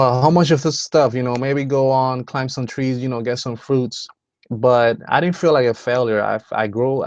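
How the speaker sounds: normal talking voice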